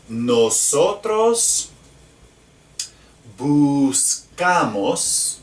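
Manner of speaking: nosotros buscamos spelled out very slowly; slow drill. Shown as a demonstration. Teacher models the conjugation slowly for class. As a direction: stretch each syllable of buscamos. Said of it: "Remember O with the accent".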